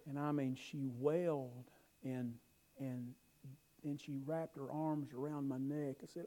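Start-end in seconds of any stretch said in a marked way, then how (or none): none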